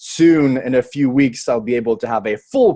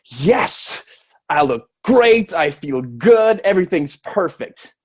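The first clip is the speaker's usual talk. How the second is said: acted-out delight, drawn-out words; self-satisfied delight; 4 semitones higher, slower than usual. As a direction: play someone thrilled with their reflection. Acts out delighted mirror reaction.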